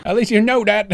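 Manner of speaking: high-pitched mock Cockney; imitated Cockney reply; Cockney accent. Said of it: "is it a Cockney accent?".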